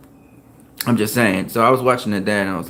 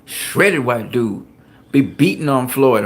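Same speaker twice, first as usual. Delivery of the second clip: ordinary delivery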